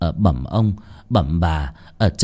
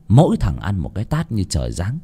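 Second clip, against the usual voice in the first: usual speaking voice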